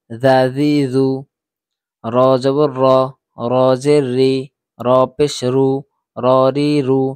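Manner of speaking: loud, clipped drill chant, syllable by syllable; loud letter drill. Shown as a demonstration. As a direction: call out each syllable loudly for the class to repeat. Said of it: "একদম তাড়াতাড়ি উচ্চারণ". (জোরে জোরে অক্ষর উচ্চারণের অনুশীলন)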